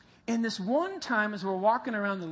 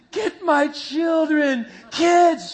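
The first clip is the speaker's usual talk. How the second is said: high, loud, slow panicked cry; terrified mother calling for her kids; 5 semitones higher, 10 dB louder, much slower than usual. Retold or imitated. Mother seeing the dog charge her children, panicked cry. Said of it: "She's thinking".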